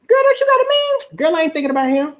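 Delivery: very high, mock girlfriend back-and-forth; imitated girlfriends. Girls talking about a man at home, high dismissive mock voice. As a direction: brush it off in a high girly voice. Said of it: "The girls get together".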